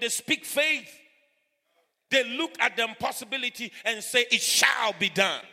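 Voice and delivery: loud, high, slow declaration of faith; full-force preaching. Acted. Preacher urging a congregation not to fear, full-force preaching. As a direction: preach it out loud with stretched words.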